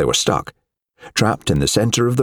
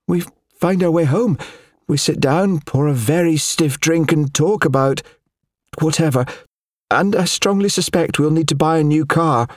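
higher, steady voice holding it together; forced calm control; 9 semitones higher than usual. A narrator's reading. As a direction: keep control for his sake. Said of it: "fighting to maintain that air of control".

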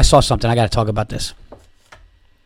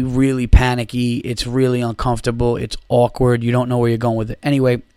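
normal talking voice

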